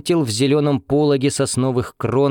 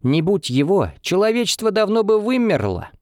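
objecting; 9 semitones higher than usual. A narrator's reading. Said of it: "возразил Митя".

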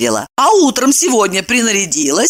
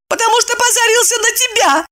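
high-pitched frank jab; blunt reply. A narrator's reading. Evdokia tells her friend off about Bob, bluntly. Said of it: "откровенно ответила Евдокия".

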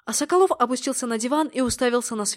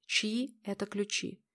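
ordinary delivery

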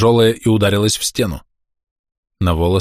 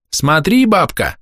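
put-on high-pitched boy's taunt; cheeky shout; 9 semitones higher, slower than usual. A narrator's reading. Boy taunts the old woman, cheeky shout. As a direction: call out brazenly in a high boy's voice. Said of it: "нахально крикнул один из мальчишек".

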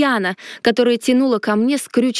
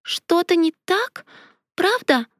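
put-on small child voice; 7 semitones higher than usual. A narrator's reading. Child Леонари asks worriedly.

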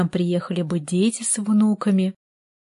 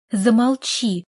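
sharp angry hiss, a little higher; 3 semitones higher, slower than usual. Acted. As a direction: hiss it through pressed lips. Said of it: "прошипела она".

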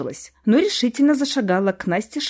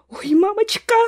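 frightened squeal; 11 semitones higher than usual. A narrator's reading. Young wife horrified by a talking spirit, squealing. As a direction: squeal it high in dismay.

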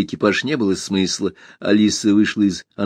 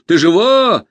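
high, loud urgent question; loud, raised-pitch cry; shouting; 9 semitones higher, 6 dB louder, slower than usual. A narrator's reading. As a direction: call out anxiously on the run. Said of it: "кричал он на бегу".

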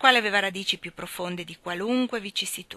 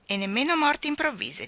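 higher, echoing aunt; Aunt Pallet, topping her; 6 semitones higher than usual. Acted. Aunt Pallet echoes her sister, higher. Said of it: "disse zia Pallet".

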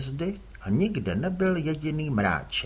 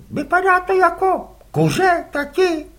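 put-on much higher boy's voice; a boy asking innocently; curious boy; 8 dB louder than usual. Les asks his father whose squid they are, boyish.

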